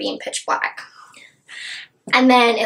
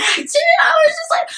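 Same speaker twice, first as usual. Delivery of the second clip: much higher laughing shout; fast, shrieking fangirl outburst; crying and freaking out; faster than usual. Retold or imitated. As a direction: burst out, half crying, half laughing. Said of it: "I was crying and freaking out".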